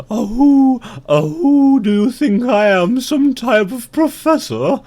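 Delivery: high, loud owl voice; hooting owl. Acted. Owl answering the ant, high hooting voice. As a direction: hoot it out as a haughty owl. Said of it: "And the owl says".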